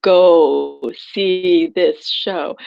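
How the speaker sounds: slow, drawn-out inner call; soul's inner voice. Retold or imitated. Inner voice of the soul urging her slowly. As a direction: let the soul urge you slowly. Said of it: "my soul is, like, clamoring".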